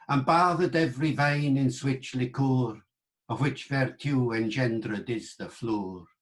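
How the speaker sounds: reconstructed Middle English recitation; Chaucer's accent. Shown as a demonstration. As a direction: roll out the verse in Middle English vowels. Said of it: "Chaucer's accent".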